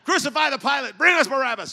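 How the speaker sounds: high, full-volume mock-angry shout; hammed-up outraged yelling. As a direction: holler it like a one-man angry mob.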